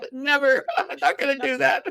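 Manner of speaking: high, loud, laughing all through; cracking up while protesting; laughing refusal. Real, not acted. Laughing at his own refusal.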